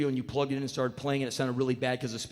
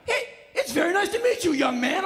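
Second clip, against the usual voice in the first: put-on friendly old star; 6 dB louder than usual. [name] answers kindly.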